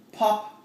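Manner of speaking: sharp high pop; popping cork sound. Shown as a demonstration. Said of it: "make a sound like a cork".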